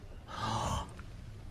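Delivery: sharp breathless intake; shocked whispered gasp. Acted. Inspector sees the still Comtesse, a hushed shocked gasp.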